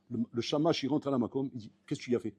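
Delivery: ordinary talk, nothing marked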